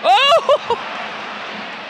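very high, drawn-out "oh"; excited whoop. Real, not acted. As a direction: whoop at a bit of football skill. Reacting to football action.

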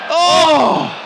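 high, loud drawn-out "oh"; mock shocked cry. Reacting to sex scene onstage.

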